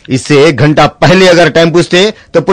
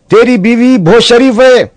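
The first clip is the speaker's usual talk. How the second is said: high mocking friend; 8 semitones higher than usual.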